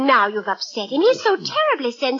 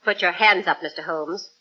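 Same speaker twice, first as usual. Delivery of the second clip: lower, softer cold threat; menacing; 6 semitones lower, 4 dB quieter than usual. Acted. Visitor turning gun on Holmes, low menacing command. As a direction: drop the sweetness and threaten. Visitor suddenly draws revolver.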